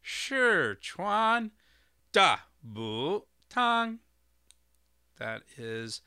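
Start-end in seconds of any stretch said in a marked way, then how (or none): 0.0-4.0 Sichuan Dabutang high and very slow, word by word; careful Mandarin tones. Shown as a demonstration. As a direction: demonstrate Sichuan Dabutang with second, second, fourth, third, first tones. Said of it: "somewhat correct pronunciation, as correct as I can".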